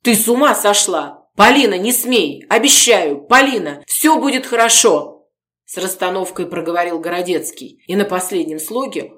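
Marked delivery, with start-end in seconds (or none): 0.0-5.3 loud, higher panic; frantic plea. Acted. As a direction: stop her at any cost. Gorodetsky frantically stopping Polina.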